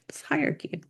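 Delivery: hushed, whispered punchline; whispered aside. Shown as a demonstration. Lecturer closing a list of reading order for students, whispered. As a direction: drop to a whisper for the last word. Hushed tag demonstrating low hierarchy.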